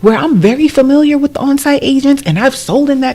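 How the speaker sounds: high, loud thrilled exclamation; shocked delight, voice way up; excited surprise. Retold or imitated. Retold surprise at neighborhood news.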